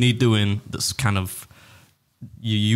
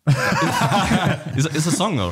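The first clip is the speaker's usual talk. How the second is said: laughing question; 7 semitones higher, much slower than usual. Real, not acted. Laughs at own mistake.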